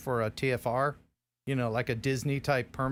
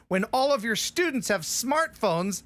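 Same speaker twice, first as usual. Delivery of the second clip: worked up; 8 semitones higher than usual. Real, not acted. Heated rant about camera rules.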